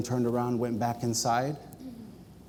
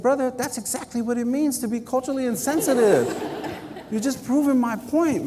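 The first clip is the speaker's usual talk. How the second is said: laughing while quoting; 11 semitones higher than usual. Retold or imitated. Retelling comeback to guard, laughing.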